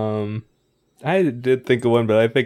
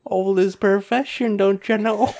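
put-on old lady voice; 9 semitones higher than usual. Continues old character.

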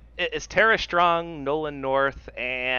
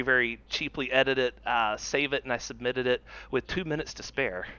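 normal talking voice

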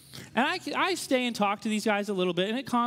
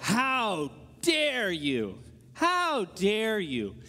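slow, drawn-out mock outrage; indignant; much slower than usual. Street preachers turning on the man's question, indignant. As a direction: stretch out each word as an imitated scandalized preacher. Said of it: "go, like, all New Testament".

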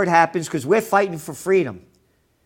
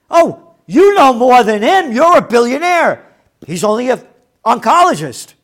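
sarcastic; 3 semitones higher, 7 dB louder than usual. Acted. Sarcastic jab at billionaire.

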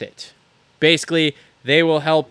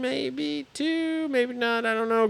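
quiet high-pitched uncertainty; high unsure aside; 10 semitones higher, 9 dB quieter, slower than usual. Pretend. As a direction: squeak it out unsure. Unsure aside about link.

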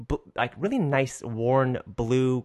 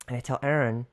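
ordinary delivery

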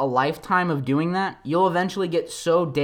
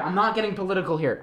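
usual speaking voice

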